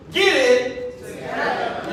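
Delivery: slow, emphatic command; hardest way. Shown as a demonstration. Preacher telling the church, slow and emphatic. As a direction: lean hard on every word. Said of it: "I'm saying it the hardest way as possible".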